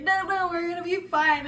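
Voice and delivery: high, urgent insisting, acted out; loud mock reassurance. Friend reassures her on slippery rocks, loud high insistence.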